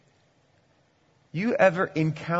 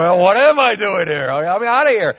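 louder, higher huffy visitor voice; offended visitor; 4 semitones higher, 7 dB louder than usual. Retold or imitated. A churchgoer snubbed at the door, mock huff. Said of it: "You might be going".

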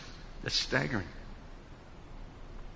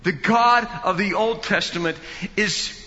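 preacher in full flight, louder and higher; full preaching; 5 semitones higher, 6 dB louder than usual. Acted. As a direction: build the sermon to a ringing climax. Preaching climax.

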